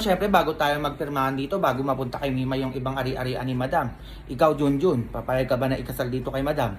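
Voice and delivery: low, gruff bodyguard tone; deep bodyguard voice. Acted. Bodyguard Lando asking Junjun about the marriage, deep voice. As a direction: play the big tough bodyguard.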